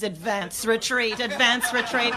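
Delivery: ordinary talk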